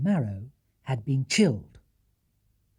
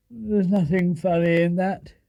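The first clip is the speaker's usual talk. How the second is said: sleepily; 4 semitones higher, 6 dB louder, slower than usual. Acted. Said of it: "replied Mister Brown sleepily".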